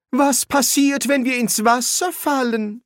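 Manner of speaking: a prim housekeeper excusing herself; housekeeper's voice. Acted. Housekeeper interrupting the quarrelling wizards, high and polite.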